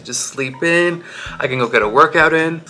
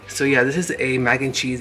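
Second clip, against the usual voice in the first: ordinary talk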